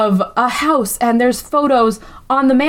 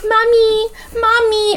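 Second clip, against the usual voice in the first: put-on whiny kid voice; 12 semitones higher, slower than usual. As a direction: nag like a spoiled kid. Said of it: "she's just like".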